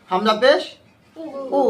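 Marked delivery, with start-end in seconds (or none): 1.6-1.6 উ said quickly, without stretching it; quick short vowel. Shown as a demonstration. Teacher shows pupils the quick vowel, উ said short. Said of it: "তাড়াতাড়ি পড়তে হবে".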